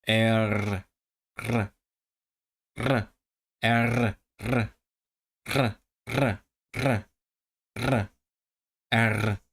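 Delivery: short repeated bursts of rolled "R"; trilled R. Shown as a demonstration. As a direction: roll each "R", some after a vowel. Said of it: "from a vowel to the trill".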